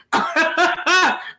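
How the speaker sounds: a short high laugh at her own jab; high amused laugh. Real, not acted. Vlogger laughing at her own jab about the abuser, high amused laugh. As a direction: let a quick high laugh slip out.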